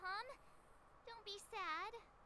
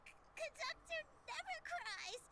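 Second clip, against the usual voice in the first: crying; 7 semitones higher than usual. Acted. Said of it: "you look pretty sad to me".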